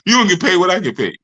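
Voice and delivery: normal talking voice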